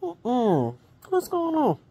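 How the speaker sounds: a worried sister calling her out; concerned sister. Retold or imitated. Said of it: "my sister was like".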